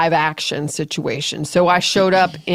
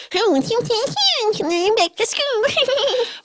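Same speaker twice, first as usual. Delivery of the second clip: high squeaky chipmunk laugh. Acted. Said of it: "that's really good".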